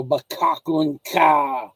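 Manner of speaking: "Bakakun ka" said very slowly, ending on a long KA; drawn-out teasing. Shown as a demonstration. Joking with Filipinos after breaking them in, drawn-out teasing "Bakakun ka". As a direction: stretch out "Bakakun ka" as a teasing joke. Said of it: "'cause of the way I say it".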